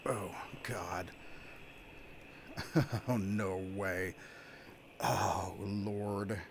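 breathless whispered awe; awed whisper. Real, not acted. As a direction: whisper it, overwhelmed. Awed on coaster.

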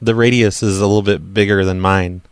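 ordinary talk, nothing marked

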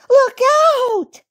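put-on very high, piercing child's shout; shouted warning. A narrator's reading. Baby Bunty warning uncle downhill, high shout. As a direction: yell a warning in a little-girl voice. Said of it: "shouted Baby Bunty".

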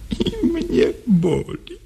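high, small voice of pain; broken doll. Acted. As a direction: let the doll cry out in pain. Doll voice returns.

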